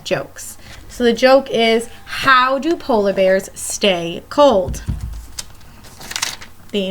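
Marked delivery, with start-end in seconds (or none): none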